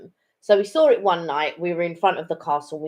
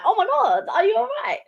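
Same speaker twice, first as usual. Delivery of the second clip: much higher, startled "oh my God"; mock concerned alarm. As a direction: play the alarmed friend checking in, pitched way up. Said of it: "and I'm just like".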